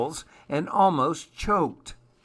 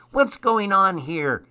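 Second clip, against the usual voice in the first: louder, higher gnome voice; demanding old gnome; 8 semitones higher, 5 dB louder than usual. A narrator's reading. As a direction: demand to know as a storybook gnome.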